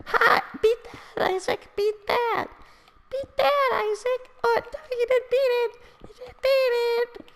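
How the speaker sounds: put-on very high squeaky kid yelling; squeaky twelve-year-old. Mocking a friend's old video as a high squeaky kid. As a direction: screech like an overexcited preteen gamer. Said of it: "you're such a squeaker".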